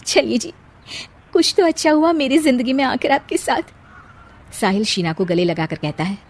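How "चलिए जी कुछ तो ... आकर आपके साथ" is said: tearful. Acted. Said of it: "Sheena की आंखों में पानी आ जाता है".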